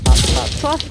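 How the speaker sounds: rapid, loud, urgent repeats; urgent excited calls. Real, not acted. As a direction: call it out fast and loud. Frantic in-game switching.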